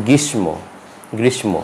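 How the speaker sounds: গ্রীষ্ম with m after "sh"; quoted mistaken গ্রীষ্ম, ending "mo"; sounded m. Retold or imitated. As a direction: say গ্রীষ্ম as "grish-mo". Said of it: "সেই ব্যক্তি এটি উচ্চারণ করেছে".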